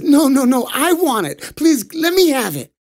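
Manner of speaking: put-on high pleading child voice; whiny little boy begging. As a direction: beg Mama like a greedy little kid. Skanda begs for sweets too.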